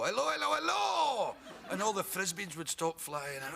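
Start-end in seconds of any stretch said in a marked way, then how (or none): 0.0-1.3 high, loud, stretched announcer call; announcer's big hello. Retold or imitated. Mexican announcer warming up the crowd, loud and high. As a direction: boom it out like a stadium MC. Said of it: "And he'd go".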